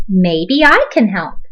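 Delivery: ordinary delivery, nothing marked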